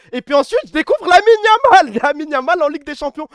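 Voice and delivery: high, loud rave about a new star; thrilled fan shouting his name; excited. Real, not acted. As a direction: gush over the wonderkid, pitch climbing. Raving about Lamine Yamal.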